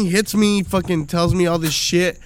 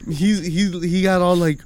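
ordinary talk, nothing marked

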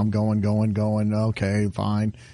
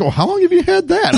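much higher, mock-concerned doctor asking about a lump; doctor's worried question; 13 semitones higher than usual. Patient imitating his doctor, mock-worried and high. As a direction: play the fretful doctor, pitched way up. Doctor voiced in retold checkup.

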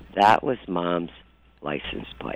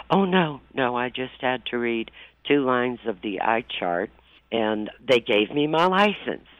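higher, louder imitation of Mom; raised, emphatic retold denial; breezy retold reassurance; 4 semitones higher, 6 dB louder than usual. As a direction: imitate a mother brushing off a worry. Retold mom brushing off the worry.

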